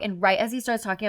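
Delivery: ordinary delivery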